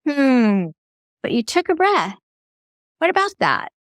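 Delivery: rising, gently curious prompt; curious probing question. Shown as a demonstration. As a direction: lean in and ask with curiosity. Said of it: "I love this one. Use this, everybody".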